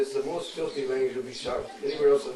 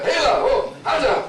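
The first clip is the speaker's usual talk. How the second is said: much higher, loud hollering; rough calling out; 12 semitones higher, 8 dB louder than usual. Retold or imitated. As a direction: holler across the lane to a friend. Villagers calling out rudely.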